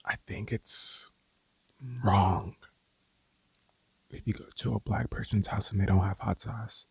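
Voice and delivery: low voiced sass dropping the whisper; sassy rant. Real, not acted. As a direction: call it out, scandalized. Hot sauce rant, voiced.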